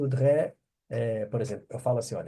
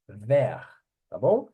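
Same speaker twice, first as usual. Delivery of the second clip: ordinary talk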